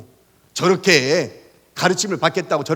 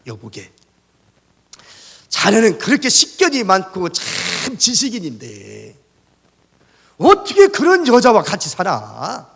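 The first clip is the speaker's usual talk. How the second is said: slow, lightly voiced old friend, rising to a louder question; an old friend chiding him in disbelief; a touch of a wondering old friend; friend's voice; 3 semitones higher, 4 dB louder, slower than usual. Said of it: "소크라테스에게 이렇게 이야기합니다".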